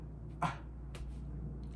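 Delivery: long breathy exhale of defeat; heavy weary sigh. Real, not acted. Fed up after waiting an hour, a heavy sigh. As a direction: let out a long tired sigh.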